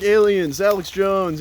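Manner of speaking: half-shouted audience suggestions; imitated audience shouts. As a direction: imitate hecklers tossing out topics. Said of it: "people are shouting out things".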